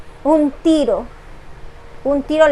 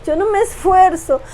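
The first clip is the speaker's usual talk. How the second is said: higher, voice breaking with real tears; pleading through tears, raised pitch; tearful testimony; 4 semitones higher than usual. Real, not acted. Woman confessing to God through real tears. Voice breaking while retelling prayer.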